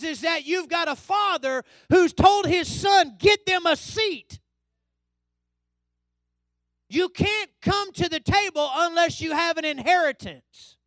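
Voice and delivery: high, loud, emphatic pulpit voice; full-force preaching. Real, not acted. Preacher assuring the church they can overcome anything, full-force proclamation. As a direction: proclaim it, stretching the key phrases slowly.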